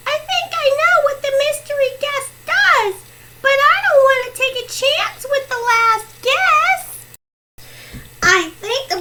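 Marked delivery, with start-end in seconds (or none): 0.1-7.0 high-pitched anxious plea; begging. Shown as a demonstration. Player stopping player two risking the last guess, begging. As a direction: beg in a much higher voice.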